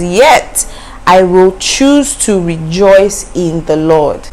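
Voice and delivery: slow, deliberate verse reading; scripture reading. Shown as a demonstration. Preacher repeating the verse to make her point, slowly. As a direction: lean on choose and take it slowly. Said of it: "it says".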